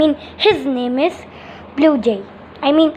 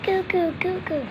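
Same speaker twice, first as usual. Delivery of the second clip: put-on small, soft, high baby coo; high little baby babble; 3 semitones higher, 9 dB quieter than usual. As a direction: coo like a pretend newborn.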